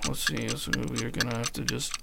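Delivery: barely audible, mumbling softly; soft talker. Acted. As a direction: speak almost too quiet to hear. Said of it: "Adam is a soft talker".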